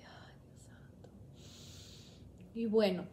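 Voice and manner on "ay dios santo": very soft, slow breathy whisper; whispered dread. Retold or imitated. Dreading the nurses, a faint whispered plea. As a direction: barely breathe the words out.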